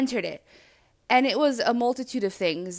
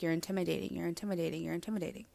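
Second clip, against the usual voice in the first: lower, softer voice fading with each repeat; murmured refrain; 4 semitones lower, 11 dB quieter, much faster than usual. Retold or imitated. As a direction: imitate a nagging message on loop. Retells a repeating message.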